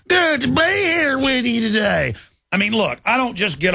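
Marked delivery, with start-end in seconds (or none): 0.1-2.1 slow, higher mock Southern Kentucky accent. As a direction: drawl it in a put-on Kentucky accent. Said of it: "from her Yankee accent to".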